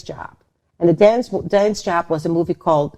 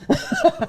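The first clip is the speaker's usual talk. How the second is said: sudden high burst of laughter. Real, not acted. Laughing at the director's "boring girl" line. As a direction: squeal a short laugh.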